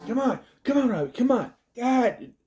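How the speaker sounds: high, pleading calls; urgent calling. Retold or imitated. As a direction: beg him to hurry up. Girl calling urgently to her dad.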